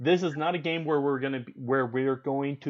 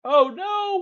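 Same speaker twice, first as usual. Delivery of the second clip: surprised oh no; 6 dB louder than usual. Real, not acted. A leader dies on roll.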